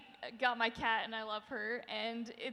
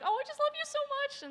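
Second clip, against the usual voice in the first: high, gushing fan voice; gushing kid; 5 dB louder, much faster than usual. Retold or imitated. Kids bawling at a fan booth, gushing high. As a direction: coo it like an overjoyed kid. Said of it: "they were like".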